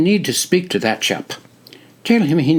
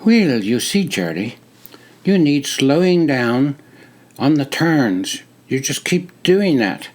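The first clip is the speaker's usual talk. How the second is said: put-on higher, crisp British-like accent giving advice; explaining in a British-like accent; 6 semitones higher than usual. A narrator's reading. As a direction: lecture a fellow recruit in a British-like accent. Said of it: "crisp, British-like accent".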